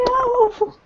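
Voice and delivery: high-pitched playful wail; mock dismay. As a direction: cry it out high, hammed-up heartbreak. Acts out upset fan reaction.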